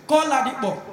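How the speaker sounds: normal talking voice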